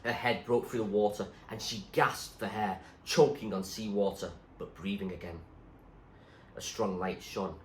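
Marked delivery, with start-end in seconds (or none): none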